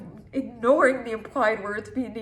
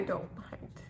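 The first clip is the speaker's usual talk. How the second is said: softer, resigned whisper; 8 semitones lower, 12 dB quieter than usual. Acted. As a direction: whisper it, giving up. Quiet resigned ending.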